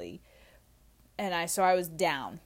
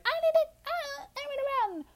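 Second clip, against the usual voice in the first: mock-dramatic, much higher voice; silly high voice. Mocking her drunk self fleeing, in a high squeaky voice. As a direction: squeak it out like a cartoon of yourself. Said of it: "It wasn't like I was just like".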